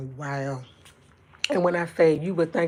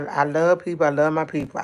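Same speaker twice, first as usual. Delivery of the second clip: ordinary delivery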